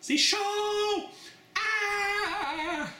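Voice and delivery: sudden high cry of pain; pained shout. Real, not acted. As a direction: yelp as the heat hits. Chili burn hits hard.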